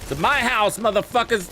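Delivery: high shouted It's my house; defiant shout. Pretend. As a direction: yell it at the enemies. Defiant game boast.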